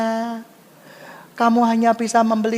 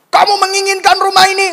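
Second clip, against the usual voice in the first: high, fast, shouted hype; motivational speaker; 10 semitones higher, 10 dB louder, faster than usual. Acted. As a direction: fire up the crowd like a mock motivator. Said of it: "dengan motivator-motivator dunia". (motivator berseru lantang berapi-api)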